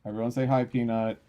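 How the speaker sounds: loud excited pet intro to viewers. Real, not acted. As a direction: show the cat off to chat. Presenting cat to viewers.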